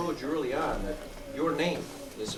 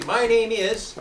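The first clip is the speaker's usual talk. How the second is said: My name is raised in pitch and loud; strong start; 5 semitones higher, 8 dB louder, much slower than usual. Shown as a demonstration. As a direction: coach a loud, firm My name is. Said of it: "Okay, say".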